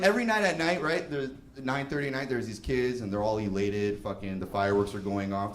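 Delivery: usual speaking voice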